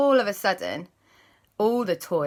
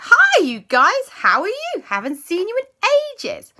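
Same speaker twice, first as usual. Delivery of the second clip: Sky's excited voice; 9 dB louder than usual. Acted. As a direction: greet old friends like an eager puppy. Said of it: "she was like".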